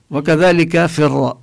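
usual speaking voice

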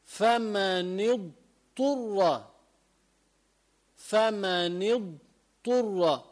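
فمن اضطر very slowly, dad then ta both clear; فمن اضطر ببطء بضاد واضحة; careful recitation. Shown as a demonstration. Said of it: "بده يلفظ ضاد بعدين طاء".

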